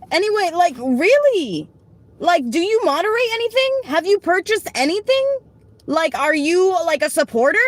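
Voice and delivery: high, annoyed scolding of a chat commenter; high-pitched irritated telling-off. Real, not acted. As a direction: tell her off, exasperated.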